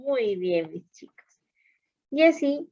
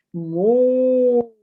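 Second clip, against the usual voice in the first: cow mooing; 5 dB louder than usual. Shown as a demonstration. Teacher voicing cow sound.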